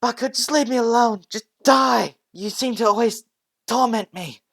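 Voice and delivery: very high, very loud screaming outburst; snapping scream. Acted. Deku snapping at Bakugo, screaming very high and loud. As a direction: scream it as he finally snaps. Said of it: "Deku just snapped".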